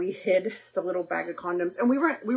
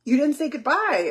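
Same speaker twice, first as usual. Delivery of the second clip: lightly voiced wounded father, higher and louder; imitating dad's sulky complaint; hurt dad; 6 semitones higher, 5 dB louder than usual. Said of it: "He'd always say".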